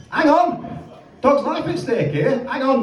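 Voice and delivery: high, urgent "Hang on!"; urgent bakery lady. Retold or imitated. Bakery woman taking the emergency seriously, high and urgent. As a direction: snap into action, high and urgent. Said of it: "she says".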